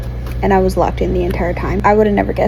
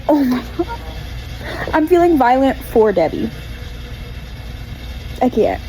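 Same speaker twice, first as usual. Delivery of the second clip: usual speaking voice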